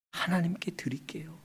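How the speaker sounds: breath-soft vow, near whisper; hushed surrender. Retold or imitated. Hannah giving her son to God, hushed vow. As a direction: offer it up quietly in acted prayer.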